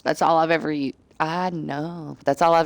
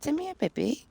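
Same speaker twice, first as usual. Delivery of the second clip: high, soft cooing to a pet; 9 semitones higher, 8 dB quieter than usual. Real, not acted. Luring her cat closer, high soft baby talk.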